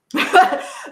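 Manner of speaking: a sudden high laugh that breaks out; high burst of laughter. Real, not acted. Panelist cracking up at her own joke, laughing high.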